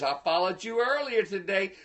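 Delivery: ordinary delivery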